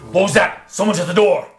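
hobgoblin voice. Acted. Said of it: "He says".

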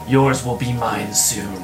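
growled wolf voice, low at the end; werewolf. Acted. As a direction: speak like a werewolf. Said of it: "he just speaks in a gravelly wolf voice".

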